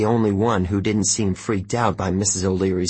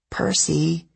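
sniffling; 7 semitones higher than usual. A narrator's reading. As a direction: call his name through tears. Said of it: "she sniffled".

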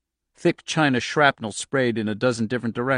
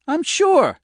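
very high, loud, tearful insistence; upset, almost crying; near tears; 6 dB louder than usual. A narrator's reading. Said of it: "She was nearly crying now".

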